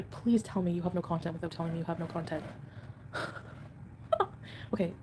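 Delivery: ordinary talk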